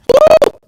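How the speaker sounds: sudden high whooping woo; loud high woo. Real, not acted. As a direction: let out a shocked, loud woo. Reacting to Elon's huge fortune.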